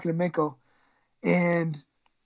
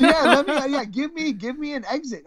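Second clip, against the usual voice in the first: hammed-up plea; 7 semitones higher than usual. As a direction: beg for a way out, half joking. Retold begging to escape practice.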